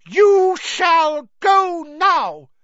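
high, loud and slow; fierce elf. Acted. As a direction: command him fiercely. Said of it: "he said fiercely".

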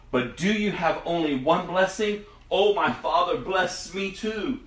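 high, begging plea, slowing at the end; pleading. Acted. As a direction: beg his father one last time. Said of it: "And Esau pleaded".

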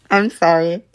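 giggling tipsy apology. Real, not acted. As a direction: laugh through the sorry. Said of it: "Sorry, I'm a little tipsy".

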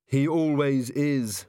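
slow, weary sigh through the words. A narrator's reading. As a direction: sigh it out, resigned to Carrot. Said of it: "sighed Angua".

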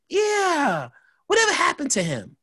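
put-on very high-pitched girlfriend voice; imitated girlfriend. Voicing the girlfriend's casual recollection.

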